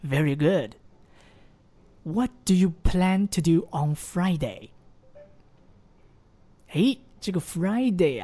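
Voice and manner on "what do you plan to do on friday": slow, every word clear; slow careful model. Shown as a demonstration. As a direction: read it slowly for the learner to copy. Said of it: "那再听我读一次". (慢速示范朗读)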